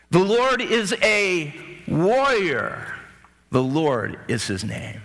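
slow, stretched, rising on Lord; slow proclaimed lyric. Acted. Moses' people celebrating, a slow drawn proclamation. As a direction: declare it like an ancient victory song. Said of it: "we know the lyrics".

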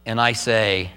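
normal talking voice

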